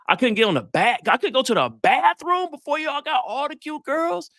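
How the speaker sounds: high, incredulous wail of complaint; mock outrage. Student back from the bathroom complaining to friends, high mock outrage. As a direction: protest loudly at your friends, pitch climbing.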